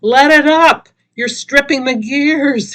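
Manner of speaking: loud, high pleading shout; begged. Acted. Donnie begging the driver over the grinding gears, loud. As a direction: beg her to stop grinding the gears. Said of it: "he begged".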